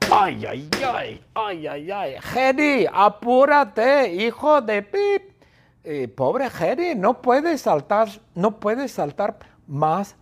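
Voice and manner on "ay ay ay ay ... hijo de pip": high, drawn-out impatient yell; high-pitched scolding cry; exasperated shout. Acted. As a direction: wail in exasperation, building to a loud holler. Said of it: "Y Bertita grita".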